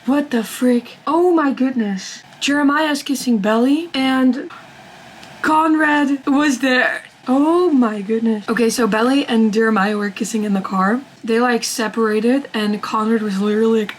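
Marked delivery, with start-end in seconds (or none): 0.0-8.5 reacting in real shock to a twist; real shocked disbelief. Real, not acted. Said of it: "I'm really in my feelings right now".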